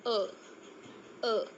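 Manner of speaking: अ drawn out, sounded alone; vowel demo. Shown as a demonstration. Teacher sounding out अ for learners. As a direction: say the vowel अ slowly and cleanly. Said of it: "इसे pronounce कैसे करते हैं".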